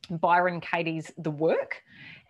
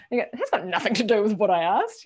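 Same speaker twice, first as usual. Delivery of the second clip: mock politician; 3 semitones higher than usual. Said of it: "You sound like a politician".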